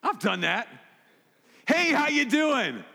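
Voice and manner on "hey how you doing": slow, forced cheerful hello, pitched high and a bit louder; sarcastically oozing fake sincerity; fake sincere greeting. Preacher mimics greeting a hated coworker with forced cheer. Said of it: "the sincerity just oozes from my voice".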